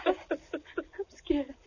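tearful, frightened. Acted. Crying girl after being hurt.

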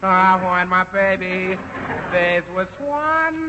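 slow, drawn-out showman croon; [name] impression. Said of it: "imitation of [name]".

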